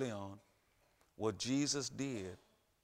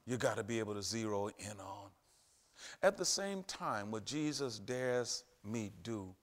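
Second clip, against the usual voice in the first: usual speaking voice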